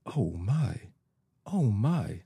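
mildly awed gasp, each "oh" swooping up; gasping wonder. A narrator's reading. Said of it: "still gasping".

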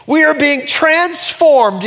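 high, slow, stretched-out declaration; bold proclamation. Real, not acted. Preacher declares the verse to congregation, bold proclamation. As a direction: draw the words out as a ringing announcement. Preaches scripture at full force.

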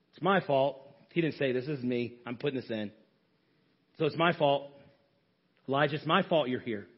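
tender voice of God. Acted. God tenderly takes the blame.

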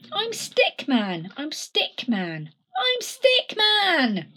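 a touch of an indignant little stick; lightly voiced Stickman, frustrated. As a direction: protest that nobody understands. Stickman's despair.

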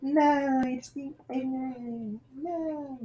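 high, soft falsetto parody; sing-song it up high and quiet. Acted. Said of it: "it's South Park's version of [name]".

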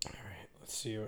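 barely-voiced whisper to himself; whispered aside. Real, not acted. Podcaster whispering to himself while finding his next topic. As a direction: breathe it out under your breath.

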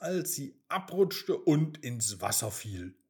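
ordinary delivery

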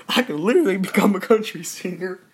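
laughing. Real, not acted. Laughing while speaking.

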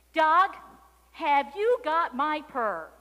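loud, high, slow kitten question; calling out. A narrator's reading. Kitten asks the dog for her purr, calling out loudly.

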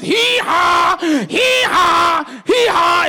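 loud, high braying hee-haw; donkey bray. Retold or imitated. Preacher braying loudly as the beaten donkey.

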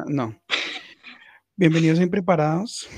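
ordinary talk

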